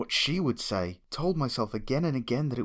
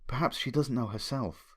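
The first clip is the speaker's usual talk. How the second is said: plain reading voice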